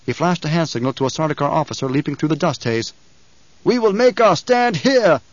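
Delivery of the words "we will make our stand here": high, slow loud command; ordering. A narrator's reading. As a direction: order it defiantly. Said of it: "the Emperor ordered".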